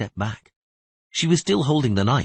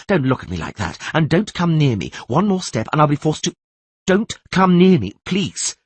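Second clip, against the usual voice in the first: tense warning; 6 semitones higher, 3 dB louder than usual. Acted. Philippa warns Geralt off.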